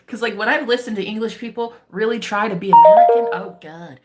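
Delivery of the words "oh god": normal talking voice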